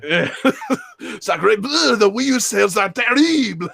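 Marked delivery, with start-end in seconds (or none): 1.2-3.7 higher, louder mock French accent. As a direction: play a hammy Frenchman. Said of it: "[name]'s French accent".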